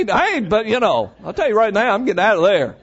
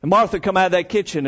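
ordinary delivery

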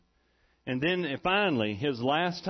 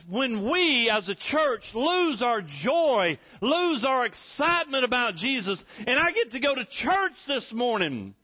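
fervent preaching; 6 semitones higher than usual. Real, not acted. Preacher exhorts the church.

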